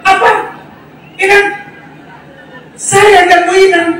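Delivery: high, hammed-up incredulous protest; mock disbelief. Preacher playing Joseph appalled by the age gap, high mock disbelief. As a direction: draw out the mock horror, high. Acts out Yusuf's imagined incredulous retort.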